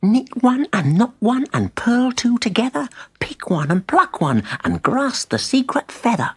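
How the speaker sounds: high, eager spell chant; excited incantation. A narrator's reading. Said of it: "Excitedly, Simeon began to read out the magic words".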